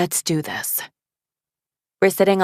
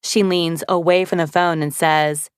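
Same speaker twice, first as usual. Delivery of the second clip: plain reading voice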